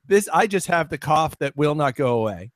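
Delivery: ordinary delivery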